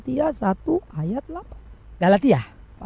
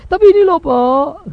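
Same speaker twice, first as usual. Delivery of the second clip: louder, higher "but there's this, Pak"; complaining congregant; 5 semitones higher, 9 dB louder, slower than usual. Retold or imitated. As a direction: blurt out the grievance to the pastor.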